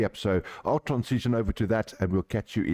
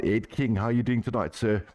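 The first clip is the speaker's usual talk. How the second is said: ordinary talk, nothing marked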